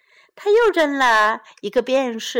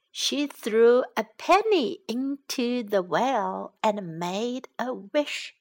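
usual speaking voice

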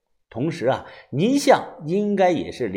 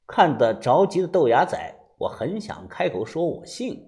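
ordinary delivery

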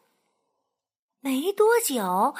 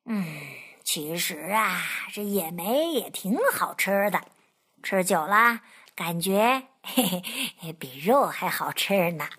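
low, musing fox voice with a chuckle; fox musing to himself; 7 semitones lower than usual. Acted. Fox talking to himself about the berries, low. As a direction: mull it over in a low voice. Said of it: "一边自言自语地说".

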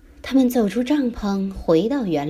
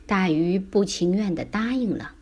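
usual speaking voice

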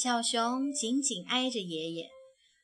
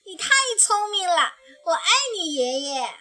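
high, loud gushing praise; delighted cub; 7 semitones higher, 8 dB louder, faster than usual. Acted. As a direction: beam with admiration. (小熊崇拜地夸爷爷，高而响亮)

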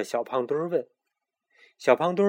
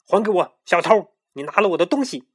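louder, higher accusing pharaoh; angry demand; 6 semitones higher, 8 dB louder than usual. A narrator's reading. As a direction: demand it back, furious. (法老愤怒地指责小偷)

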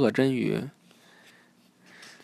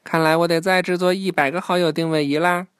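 playful, higher-pitched character line; joking; 5 semitones higher, 4 dB louder than usual. A narrator's reading. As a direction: tease lightly with a raised, lilting voice. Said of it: "开着玩笑说".

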